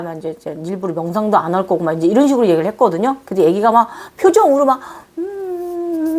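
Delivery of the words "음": high, soft, drawn-out whimper; mock sulky whimper. Fairy child whimpering when told to stay away. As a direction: imitate a pouting child's whine. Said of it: "막 이러면서 막 너무 귀엽게 섭섭해하는".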